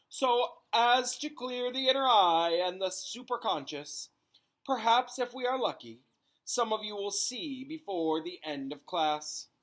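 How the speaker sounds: high, theatrical fortune-teller voice; mystic seer. A narrator's reading. The divination teacher addressing her class, high and mystical. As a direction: play the grand, otherworldly seer.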